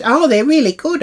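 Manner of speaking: high, loud gushing voice; mimicking Jill. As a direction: parody a chirpy guest. Jill's chirpy reply mimicked.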